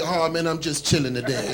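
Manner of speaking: low, soft, lazy stoner voice. Retold or imitated. Comic imitates a stoner driver, low, soft and lazy. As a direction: sink into a mellow stoner murmur. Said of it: "They be like".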